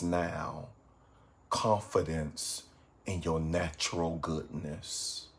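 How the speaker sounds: soft, unhurried meditation voice with long pauses; guided meditation. Acted. Meditation teacher guiding evening viewers to reflect on goodness, guided meditation. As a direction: guide them gently into reflection, low-key and calm.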